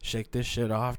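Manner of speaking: ordinary delivery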